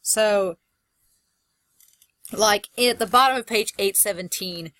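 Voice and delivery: normal talking voice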